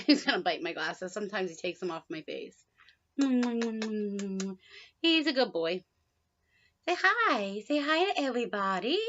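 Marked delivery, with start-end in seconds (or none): 3.2-5.8 very slow drawn-out coo. Real, not acted. Cooing at bird. 6.9-9.0 sing-song to the bird; pet talk. Real, not acted.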